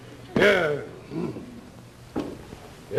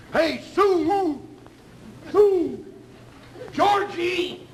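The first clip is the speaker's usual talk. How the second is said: calling out; 5 semitones higher, much slower than usual. Acted. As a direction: call out for the girl. Calling out across the saloon.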